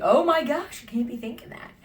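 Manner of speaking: high, loud burst of disbelief; mock shock. Storyteller acting out her own reaction to a man, mock shocked. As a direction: exclaim it in exaggerated outrage.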